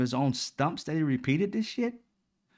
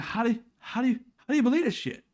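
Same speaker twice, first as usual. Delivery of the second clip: how could anyone believe this, said higher; incredulous; 10 semitones higher, faster than usual. Retold or imitated. Retold disbelief at MAGA listeners, voice shooting up high. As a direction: pitch jumps way up in disbelief. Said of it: "I'm like".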